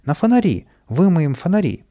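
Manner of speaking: high, loud excited urging; азартный крик. A narrator's reading. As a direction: shout wild orders upward. Каприска азартно командует со шлангом. (азартно, громко командует)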